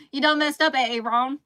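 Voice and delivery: laughing, loud exaggerated Southern accent on the meme line; emphasized Southern accent. Shown as a demonstration. Quoting the A A Ron meme to chat, in a hammed-up Southern accent. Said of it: "emphasized Southern accent on accident".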